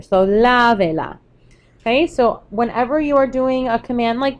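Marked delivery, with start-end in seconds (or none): 0.3-1.2 "lavela" stressed on the la at the start; stress on first syllable. Shown as a demonstration. Teacher adding la to lave, stress kept on the first syllable. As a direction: keep the emphasis on the first a of "lavela". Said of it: "still have the emphasis there".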